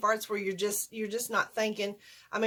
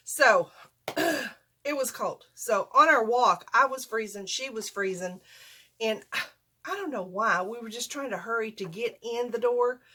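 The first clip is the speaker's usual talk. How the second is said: usual speaking voice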